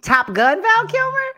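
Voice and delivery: high, loud disbelieving question; surprised. Real, not acted. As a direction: ask it in pure disbelief. Incredulous question.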